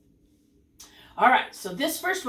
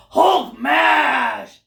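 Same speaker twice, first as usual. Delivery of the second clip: huge mad roar; loud, drawn-out exclamation; Hulk; 3 semitones higher, 10 dB louder than usual. Shown as a demonstration. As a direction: shout it like an angry giant. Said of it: "do you hear the difference".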